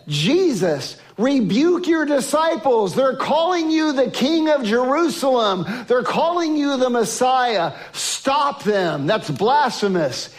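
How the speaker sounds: a touch of scandalised protest; outraged Pharisees. Retold or imitated. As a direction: Pharisees demanding Jesus silence his disciples, mildly outraged. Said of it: "were outraged".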